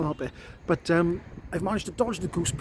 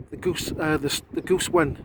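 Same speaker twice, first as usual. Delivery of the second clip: ordinary talk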